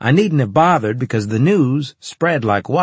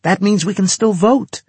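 very high, louder, eager voice; excited announcement; 11 semitones higher, 5 dB louder than usual. A narrator's reading. Natasha explains to her squadmates what the link means, excitedly. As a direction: announce the breakthrough, pitched right up.

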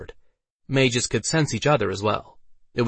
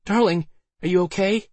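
high-pitched anxious female character voice; concerned Alice; 11 semitones higher, 3 dB louder than usual. A narrator's reading. Alice to Waldo who just fell, concerned. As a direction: call it high, checking he is unhurt.

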